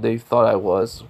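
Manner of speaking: ordinary delivery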